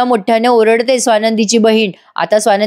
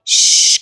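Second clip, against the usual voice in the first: a breathy hushing sound; sharp shush; slower than usual. Retold or imitated. Mother hushing her daughter because guests are downstairs, sharp shush. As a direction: hush her up quickly. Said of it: "आता अधिराची आई चिडते".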